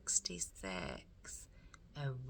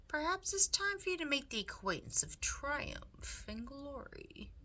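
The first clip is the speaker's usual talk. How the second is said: higher, smooth scheming rat, coaxing; Roscoe the rat; 9 semitones higher than usual. Acted. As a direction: purr it like a sly, charming villain. Roscoe voiced in story.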